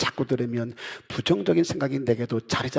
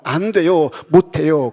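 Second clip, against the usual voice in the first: refusing loudly; 3 semitones higher, 10 dB louder than usual. Retold or imitated. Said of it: "그랬던 에스더가".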